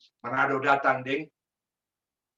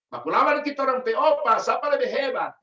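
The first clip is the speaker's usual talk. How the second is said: put-on higher, boastful Gorontalo accent; 8 semitones higher than usual. Gorontalo man boasting about his grandfather, Gorontalo accent. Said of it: "Nah, ini logatnya Gorontalo".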